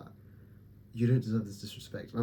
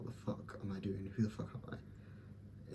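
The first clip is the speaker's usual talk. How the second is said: muttered to himself; 12 dB quieter than usual. Real, not acted.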